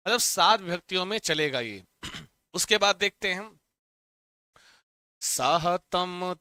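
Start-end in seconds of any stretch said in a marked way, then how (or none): 5.2-6.4 साहतम stretched very slowly; slow recitation. Shown as a demonstration. Teacher modelling the form, saying साहतम very slowly. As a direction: draw out साहतम for the class. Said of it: "एक वचन कैसे चलेगा".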